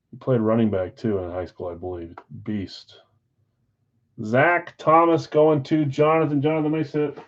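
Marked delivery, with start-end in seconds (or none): none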